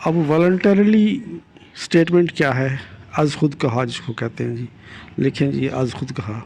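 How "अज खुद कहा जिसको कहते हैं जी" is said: ordinary talk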